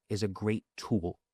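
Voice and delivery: ordinary talk, nothing marked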